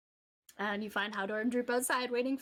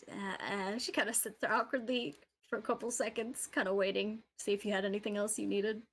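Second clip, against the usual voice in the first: ordinary delivery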